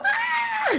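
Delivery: high joyful shriek; excited scream. Shown as a demonstration. Showing viewers how she greets loved ones, joyful shriek. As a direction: scream like a happy child. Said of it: "I'm gonna go".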